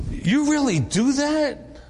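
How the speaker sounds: slow, high-pitched disbelieving question; surprised. Retold or imitated. Pastor spots the prayer list at a clergy meeting, surprised. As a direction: draw it out high, as if taken aback. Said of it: "they were surprised".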